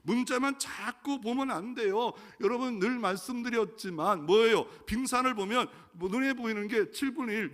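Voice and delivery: loud, high sermon delivery; fervent preaching. Real, not acted. Preacher pressing the point to the congregation. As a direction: preach it with full fervour.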